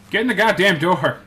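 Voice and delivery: loud, high exasperated shout; frustrated yell. Real, not acted. Player yelling at a stubborn cow, fed up. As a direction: finally lose patience with the cow. Cow won't enter the pen.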